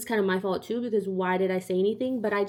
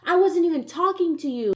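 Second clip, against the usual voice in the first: retold yelling back; 6 semitones higher than usual. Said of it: "Yelling back at him".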